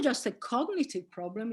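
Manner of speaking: usual speaking voice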